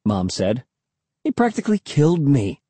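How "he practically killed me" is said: put-on high-pitched kid's exclamation; mildly excited, high voice; exclaiming. A narrator's reading. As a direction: blurt it out, pitched up. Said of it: "I exclaimed".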